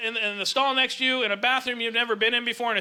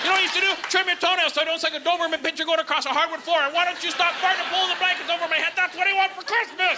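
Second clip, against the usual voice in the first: pitched-up mock nagging wife; 6 semitones higher, faster than usual. Comic as wife listing what she wants for Christmas, mock nagging. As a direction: rattle off complaints as the exasperated wife.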